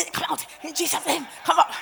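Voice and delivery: high-pitched exorcist's order; straining command. Retold or imitated. Preacher re-enacting her command to the demon, straining. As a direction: push the command out high and urgently.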